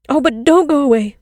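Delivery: put-on children crying to the girl not to go. A narrator's reading. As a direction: call her back before she leaves. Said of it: "they cried".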